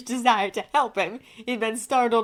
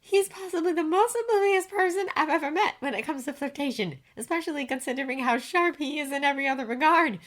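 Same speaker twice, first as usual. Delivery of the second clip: high, chatty woman's voice; higher-pitched female character, pondering; musing woman; 8 semitones higher than usual. A narrator's reading. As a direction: muse aloud, pitched well up. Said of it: "she mused thoughtfully".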